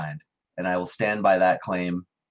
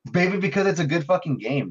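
excited; 9 semitones higher, 4 dB louder than usual. Real, not acted. Heated defense of favorite game.

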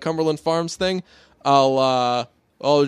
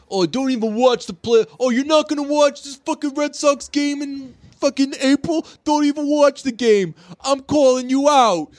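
high-pitched, hectoring fan voice; whiny sports fan; 10 semitones higher than usual. Acted. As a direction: call them out like an indignant fan. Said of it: "when I was a little sports bitch".

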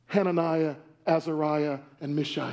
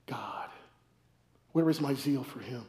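much lower and far softer, turning inward; quiet, lowered confession to God; hushed prayer; 8 semitones lower, 8 dB quieter than usual. Acted. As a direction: drop to a near-murmur of self-rebuke. Hushed self-rebuke after sports list.

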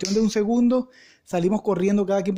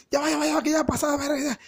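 high, frantic rapid repeats; urgent hurrying; 8 semitones higher than usual. Acted. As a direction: urge your accomplice to wait, nearly there. Friends urgently forcing the bar. (voz aguda y apurada)

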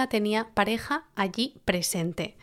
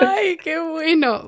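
laughing; 7 semitones higher, 3 dB louder, much slower than usual. Real, not acted. Amused by her friend.

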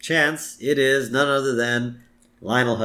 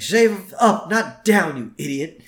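much higher, loud scolding; yelling order; 7 semitones higher, 6 dB louder, slower than usual. Retold or imitated. Woman scolding Lenny over shaving, loud and high. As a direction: snap the correction at him. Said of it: "she even yells at him".

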